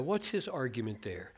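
ordinary talk

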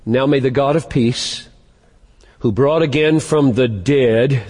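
slow, deliberate reading of the verse; read slowly. Shown as a demonstration. Preacher rereads the verse for the congregation, slowly. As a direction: let each phrase of the verse sink in slowly. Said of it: "Read it again slowly".

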